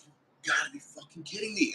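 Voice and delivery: hushed incredulous aside; inner disbelief. Retold or imitated. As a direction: say it quietly, as if thinking it. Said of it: "In my head".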